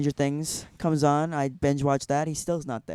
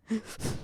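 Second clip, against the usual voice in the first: sharp imitated gasp before crying out; a mock hurt gasp; tearful gasp; 8 semitones higher, 12 dB quieter than usual. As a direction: gasp in like he's about to cry. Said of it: "that's exactly what he sounded like".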